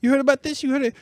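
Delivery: eager excitement. Real, not acted. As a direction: pitch it like hot gossip you can't hold in.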